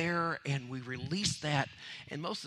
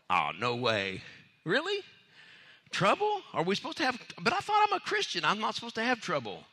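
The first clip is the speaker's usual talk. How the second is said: high, loud incredulous questions; mock disbelief; 6 semitones higher, 4 dB louder than usual. Preacher mocking a believer's shock at trouble, incredulous.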